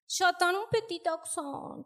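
high, patronizing imitation; mock someone cooing at a little dog; condescending pet-talk voice; talking to a puppy. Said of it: "ils me parlent comme si j'étais un petit chien".

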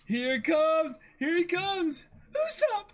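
loud, high, laughing cheer; excited cheering. Real, not acted. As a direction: cheer him on at full volume. Reacting to Usopp's arrival.

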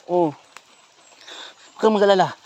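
ordinary talk, nothing marked

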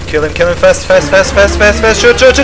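frantic callouts, rising; urgent shouting. Real, not acted. Final fight, urgent callouts.

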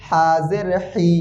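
slow Arabic-style হি from the throat. Shown as a demonstration. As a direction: push the হা sound up from the throat, drawn out. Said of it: "গলার ভিতর থেকে চাপ দিয়ে আসবে".

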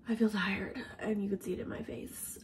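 whispering, worn out; tired whisper. Real, not acted. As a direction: breathe it out in a whisper. Tired, signing off to rest.

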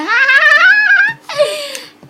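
long, loud high-pitched squeal; playful piercing squeal; mock squeal. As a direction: let out a high, drawn-out mock wail. Squeals after joking she is single.

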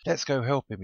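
high, loud excited squeal; eager squeal. A narrator's reading. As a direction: squeal it out, raring to go. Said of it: "he squealed".

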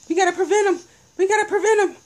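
high frantic screech; falsetto shriek. Real, not acted. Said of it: "We gotta prevent 'em".

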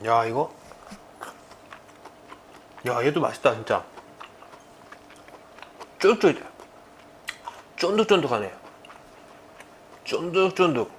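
talking while chewing; savoring a bite; mouth full. Real, not acted. Seen 0: Puts food in mouth at 1.1, chews with eyes closed throughout.

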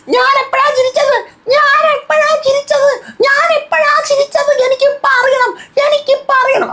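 very high, squeaky mock voice, drawn out; shrill-pitched parody of the angry housemate; mocking Rena. Vlogger mocking Rena demanding when she laughed, squeaky high parody.

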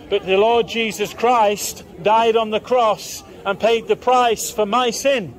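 ordinary talk

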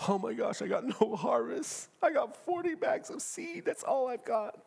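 mildly acted dismay; worried farmer. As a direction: play a farmer fretting over the harvest. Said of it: "Can you imagine a farmer?".

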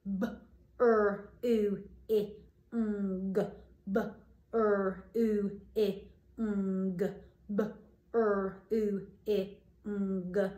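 sounding out ch, oo, I, ng one sound at a time; segmenting into sounds. Shown as a demonstration. Teacher sounds out a word for children, one sound at a time. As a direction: separate each phoneme with a pause. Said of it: "Let's see whether you're right".

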